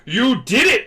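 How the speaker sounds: high, loud cheer; imitated jubilant praise; excited congratulation. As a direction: burst out with acted pride. Acts mentor's excited congratulation.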